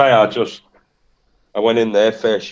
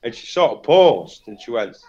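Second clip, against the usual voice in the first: normal talking voice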